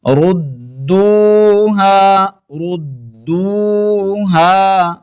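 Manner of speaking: slow, drawn-out melodic tilawat; Quran recitation. Acted. (ترتیل کے ساتھ تلاوت)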